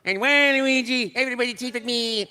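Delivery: high, quick cartoon voice; squeaky video game hero; cartoon character voice. Character voice at fan event.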